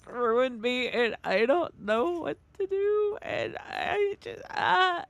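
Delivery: imitated wailing, high and loud, dragging out the words; hammed-up tearful whine; mock crying breakdown. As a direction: re-enact your over-the-top sobbing meltdown. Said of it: "this is exactly how I sounded".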